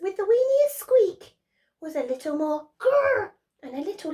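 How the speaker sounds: high, eager little mouse; a small mouse dreaming big; squeaky mouse wishing aloud. Acted. Mouse character's speech.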